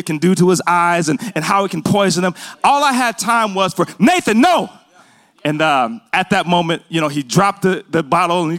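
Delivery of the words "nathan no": urgent yell. Retold or imitated. Father stopping his son grabbing Lysol, urgent yell. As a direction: yell to stop a toddler in his tracks. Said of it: "the reason for me yelling".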